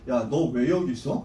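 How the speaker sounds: low, slow voice of God, lightly acted; hint of a deep divine voice; God's voice. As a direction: ask as God, pitched well down and unhurried. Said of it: "하나님의 음성을 듣습니다".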